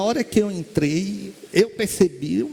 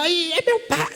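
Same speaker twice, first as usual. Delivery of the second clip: much higher, sudden cry of recognition; startled recognition; 12 semitones higher than usual. Retold or imitated. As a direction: gasp it out as if you see your dead father. Daughter recognizes father's spirit.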